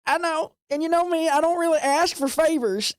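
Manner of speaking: pleading NPC. Acted.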